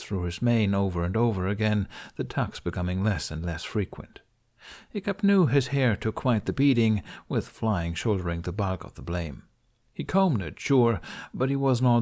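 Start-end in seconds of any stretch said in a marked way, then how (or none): none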